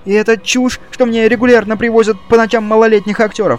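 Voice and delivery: high, loud, breathless panic; gasping for air. A narrator's reading. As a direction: swallow word endings in terror. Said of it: "Голос у него окончательно сел".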